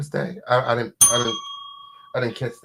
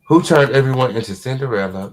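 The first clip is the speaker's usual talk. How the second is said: normal talking voice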